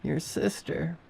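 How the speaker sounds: high, soft, eerie copy of a voice; like a recording played back; wrong, mimicking voice. A narrator's reading. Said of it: "the way that she said it was wrong".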